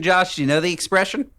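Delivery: ordinary talk, nothing marked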